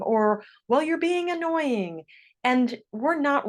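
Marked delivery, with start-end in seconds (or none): 0.7-2.0 mock defensive comeback, pitched up high. Accused kid hitting back at the other, mock defensive. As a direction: fire back in a higher, put-upon tone. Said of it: "can easily get very defensive".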